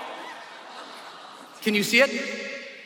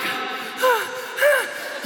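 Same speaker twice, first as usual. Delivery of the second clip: high, drawn-out scared breaths; acted hyperventilating gasp; panicked gasp; 11 semitones higher than usual. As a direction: gulp air like you're about to jump. Panicking at the plane door.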